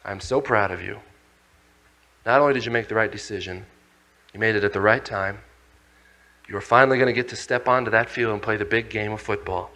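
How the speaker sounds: low, soft, proud praise; proud tender father. Retold or imitated. Father telling the children he is proud, softly. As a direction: praise them gently, full of pride.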